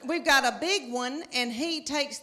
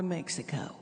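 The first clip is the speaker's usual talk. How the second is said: whispered; 7 semitones lower, 8 dB quieter than usual. Acted. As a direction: whisper the place name. Whispered aside.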